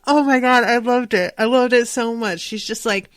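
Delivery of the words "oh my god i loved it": ordinary talk, nothing marked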